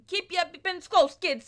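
loud, high, badly faked Italian accent. Italian man warns kids about EpiPens, loud mock Italian accent. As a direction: bark it in a hammy Italian accent. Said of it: "that's my impression of, like, um, an Italian man".

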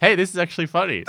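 high, loud amused viewer voice; surprised viewer. Retold or imitated. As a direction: sound pleasantly caught off guard. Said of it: "And they're like".

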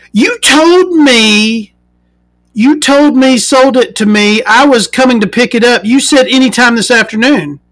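high, whiny complaining voice; whiny buyer voice. Retold or imitated. Angry buyer complaining to the seller, high whiny voice. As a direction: whine it like the wronged buyer.

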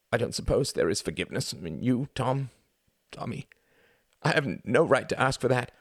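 high, loud anguished sobbing; weeping plea. A narrator's reading. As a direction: beg through the tears. Pleading for forgiveness, weeping.

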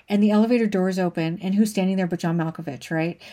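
usual speaking voice

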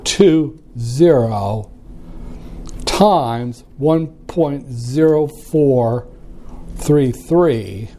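slow, each digit spaced out; key in the numbers slowly, aloud; dictating. Shown as a demonstration. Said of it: "I have to use the calculator".

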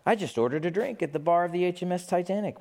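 normal talking voice

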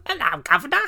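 loud, high, hammed-up British accent; mock British accent. British person mocking their own attempts at a hammed-up British accent. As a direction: shout it as a caricature Brit. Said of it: "Do a British accent".

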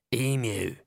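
ordinary delivery

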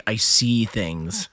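slow, lightly mocking seer; slow, hinted mystic voice; teasing imitation. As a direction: draw it out like a mock psychic. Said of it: "you're over here like".

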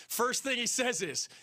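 ordinary talk, nothing marked

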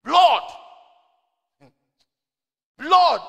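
full shouted cry of the word Blood; preacher crying out Blood to the congregation; loud cry. Real, not acted. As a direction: ring it out to the whole church.

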